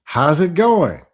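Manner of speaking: mock sugary text greeting; sweet fake greeting.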